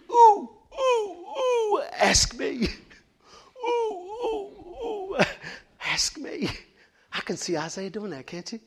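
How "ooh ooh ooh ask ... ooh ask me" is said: much higher, squealing ooh-ooh pick me; eager student. Retold or imitated. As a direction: bounce like a kid desperate to answer. Eager student raising hand.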